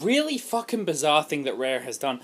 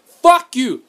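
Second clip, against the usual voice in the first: loud, high shouted retort; angry retort; 9 semitones higher, 14 dB louder than usual. Acted. Gamer rebuts rival fans, angry shout. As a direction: shout down the doubters.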